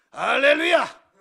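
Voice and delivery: huge, high hammed-up hallelujah; booming snake-oil seller greeting. Evangelist greets startled kids at a retreat, mock booming shout. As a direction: belt it out like a showman evangelist. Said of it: "그런 목소리 처음 들어봤거든요".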